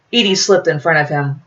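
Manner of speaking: usual speaking voice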